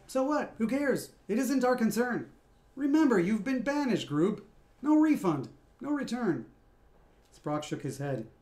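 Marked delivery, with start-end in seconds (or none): 0.1-6.5 scornful. Acted. Scat dismisses Sprock, high scornful brush-off. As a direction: wave their worries away with contempt.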